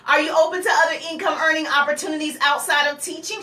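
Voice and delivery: quick, brisk pitch question; talking fast. Shown as a demonstration. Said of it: "You're talking fast because she was moving fast".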